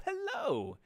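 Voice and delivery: high, bright sing-song hello; excited greeting. Real, not acted. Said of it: "I didn't actually say hello".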